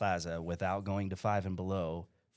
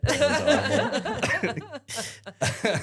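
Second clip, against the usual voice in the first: very slow, high laughing groan; 5 dB louder, much slower than usual. Real, not acted. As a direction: drag it out laughing. Laughing self-deprecation.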